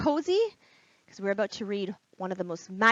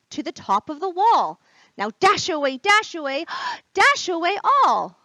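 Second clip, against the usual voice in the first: Santa calling his reindeer, louder; 6 semitones higher, 8 dB louder than usual. Acted. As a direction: shout the names across the sky.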